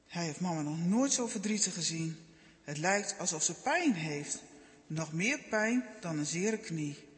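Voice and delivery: ordinary delivery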